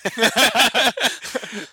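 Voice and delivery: high, loud laugh; real amused cackle; burst of laughter. Real, not acted. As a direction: crack up loudly at a tease. Laughing at friend's tease.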